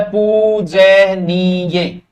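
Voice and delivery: पूजनीय drawn out very slowly, syllable by syllable; spelled-out pronunciation. Shown as a demonstration. Teacher spells पूजनीय out to students, very slow. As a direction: stretch every syllable of पूजनीय for the class. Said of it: "पूजनीय शब्द ऐसे लिखा जाता है".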